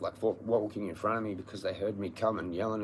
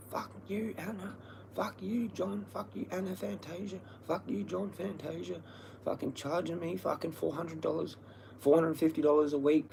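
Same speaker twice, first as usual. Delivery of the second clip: mock yell, pitched much higher but softer; retold yelling; 8 semitones higher, 5 dB quieter than usual. Retelling how he yelled at the landlords in the cave, mock yell. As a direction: replay the cave yelling, high and strained. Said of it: "I'm yelling all this shit".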